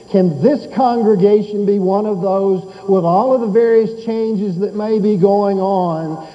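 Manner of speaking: rising, fervent sermon appeal; preaching. Acted. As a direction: press the congregation, building. Preaching at full force.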